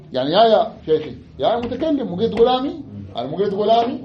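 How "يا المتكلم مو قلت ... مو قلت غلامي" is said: high, quizzing teacher voice; raised questioning. Shown as a demonstration. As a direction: prod the class with a high questioning tone. (نبرة سؤال مرتفعة لتنبيه الطلاب)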